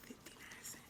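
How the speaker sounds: breathy whispered price. Real, not acted. Crafter whispering the price to herself, hushed. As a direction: whisper it like a secret bargain.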